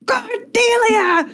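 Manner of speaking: high, drawn-out echoing call; slow, high-pitched mock shout; imitated shout. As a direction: imitate a distant bellow of a name. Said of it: "the second shout of".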